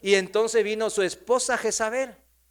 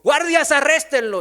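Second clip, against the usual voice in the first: high, loud acted order; shouted command; 9 semitones higher, 8 dB louder than usual. King orders his guards to seize Nabot, shouted command. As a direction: call out to the guards with force.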